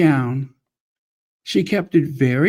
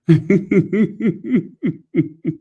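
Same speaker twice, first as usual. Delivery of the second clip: amused laugh; 5 semitones higher than usual. Real, not acted. Laughs at own word choice.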